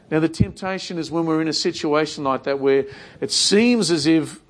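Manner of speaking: ordinary talk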